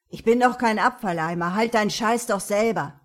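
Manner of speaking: put-on loud, fast angry teen. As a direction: yell it back at her. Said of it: "Der Gothic wurde jetzt laut".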